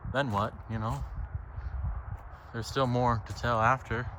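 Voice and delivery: normal talking voice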